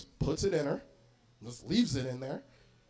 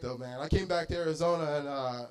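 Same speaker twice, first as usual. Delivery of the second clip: ordinary talk, nothing marked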